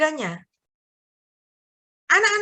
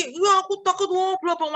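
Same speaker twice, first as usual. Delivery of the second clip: higher-pitched imitated crying; mock tearful whine; 4 semitones higher than usual. Niece tells uncle she fears the exam, mock tearful whine. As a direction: whine it out like a scared student. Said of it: "Pokoknya nangis".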